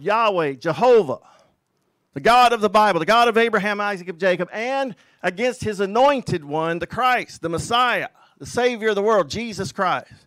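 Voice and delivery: loud, rising pulpit declamation; preaching at full force. Acted. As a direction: thunder it out like a revival preacher. Preacher builds over the psalm.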